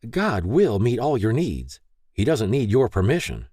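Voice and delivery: lightly voiced stern mom; firm mother voice. A narrator's reading. Mary voiced by narrator.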